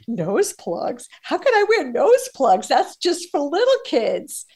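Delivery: high, indignant retold protest; incredulous scoff. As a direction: act offended at the idea. Retelling reaction to coach's advice.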